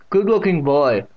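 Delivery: loud deaf great-aunt's voice; Catherine. A narrator's reading. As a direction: speak out distinctly as the deaf aunt. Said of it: "with that distinct pronunciation".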